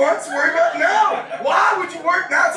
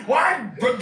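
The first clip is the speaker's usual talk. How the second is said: ordinary delivery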